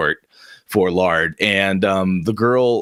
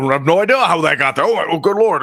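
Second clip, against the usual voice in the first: panicked stammer; 6 semitones higher, faster than usual. Acted.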